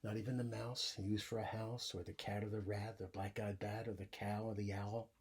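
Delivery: put-on lively read-aloud for young kids; story reading. A narrator's reading. Teacher reading a bedtime story to the class, animated. Reads picture book aloud.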